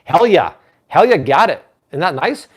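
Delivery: ordinary delivery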